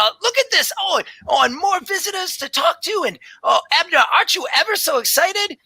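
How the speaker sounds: very high, breathless delight; excited tabaxi druid. Acted. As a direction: gush like a wide-eyed scholar.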